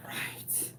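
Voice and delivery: voiceless, hushed reply; whispered agreement. Retold or imitated. Mother confirming her son's explanation, whispered. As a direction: breathe it out under your breath.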